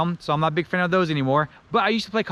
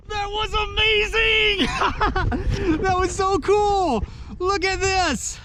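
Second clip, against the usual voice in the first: excited shout; much slower than usual. Real, not acted. Clip of his own excited reaction.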